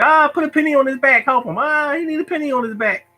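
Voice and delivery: high, whiny mocking schoolkid; teasing kids. Kids comparing him to a skipping record, high taunt. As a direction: taunt in a high voice with long drawn Ahs. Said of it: "They was like".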